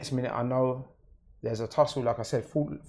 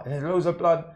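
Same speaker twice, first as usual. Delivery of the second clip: ordinary delivery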